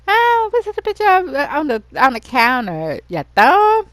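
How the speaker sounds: high, loud mocking surprise; mock the photo, high and loud. Friend mocks a bad photo.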